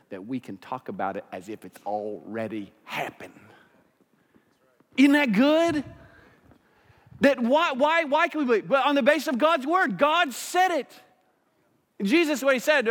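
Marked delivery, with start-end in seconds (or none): 5.0-12.9 high, loud fired-up preaching; preaching at full force. Acted. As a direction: lift the room, preach it hard.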